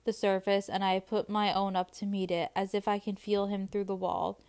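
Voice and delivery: soft, quiet reading aloud; story narration. A narrator's reading. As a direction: read the passage gently. Reading a passage from the book.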